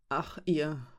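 leise, resigniert geseufzt; seufzend. A narrator's reading. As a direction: mit einem müden Seufzer sagen. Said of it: "seufzte Sindra". (Sindra seufzt leise über ihre Freunde)

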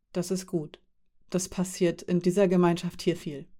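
ordinary delivery

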